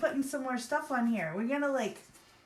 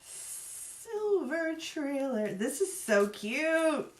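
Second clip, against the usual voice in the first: delighted; 6 semitones higher, much slower than usual. Real, not acted. Reveals cute trailer.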